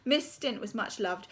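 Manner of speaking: plain reading voice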